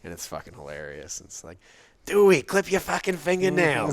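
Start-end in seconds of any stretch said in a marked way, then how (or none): 2.0-3.9 put-on loud, high Boston accent yell. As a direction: shout it like a Boston local. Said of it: "representing, like, the, a Bostonian".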